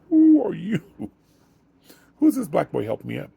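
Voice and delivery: high startled "who are you"; surprised older lady. Retold or imitated. Startled lady in retold scene.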